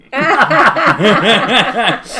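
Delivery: loud high laughter; hearty laugh. Real, not acted. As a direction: burst out laughing. Laughing at own joke.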